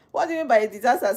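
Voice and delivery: incredulous laughing repeat of the question; laughing disbelief. Real, not acted. Streamer laughing at a viewer's comment, fast and incredulous.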